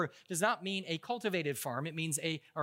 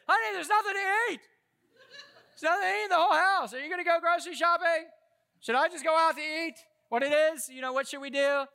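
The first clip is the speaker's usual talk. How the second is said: high, pleading husband voice; mock helpless husband whining to his wife; whiny husband; 11 semitones higher, 7 dB louder than usual. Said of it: "I'm such a loving husband, I say".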